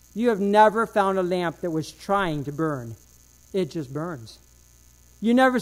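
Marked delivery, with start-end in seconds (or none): none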